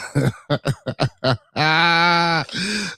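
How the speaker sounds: real laugh rising into a high, drawn-out cackle. Real, not acted. Host cracks up mid-intro at a friend's joke, laughing. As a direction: burst out in a long, high laugh. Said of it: "I'm laughing because of my blood".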